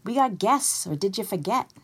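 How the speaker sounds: high-pitched shouted nagging. A narrator's reading. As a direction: shout to cut her off. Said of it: "and shouted".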